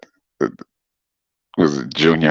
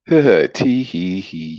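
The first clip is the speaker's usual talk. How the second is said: slow mock giggle, higher; a playful, hammed-up tee hee; coy laugh to the chat; 5 semitones higher, much slower than usual. Giggling after coy remark.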